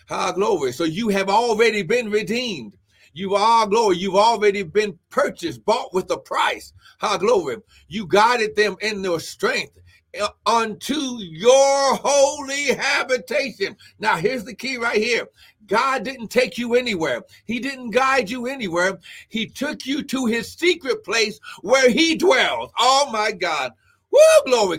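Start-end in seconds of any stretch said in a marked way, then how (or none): 0.7-23.7 higher, loud, driving preacher's delivery; preaching. Real, not acted. Preacher telling believers they are already redeemed, forceful preaching. 24.1-24.8 very high, loud praise shout; ecstatic whoop. Real, not acted. Preacher overcome mid-sermon by the word, ecstatic whoop.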